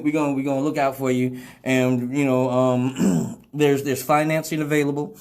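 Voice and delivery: normal talking voice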